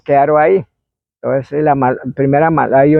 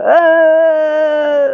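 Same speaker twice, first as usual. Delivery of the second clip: a much higher, far-off drawn-out yell; loud spirit scream; 13 semitones higher than usual. Retold or imitated. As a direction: let the spirit's scream ring out, louder and longer. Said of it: "gritó, ahí sí ya era duro". (grito fuerte y prolongado del espíritu)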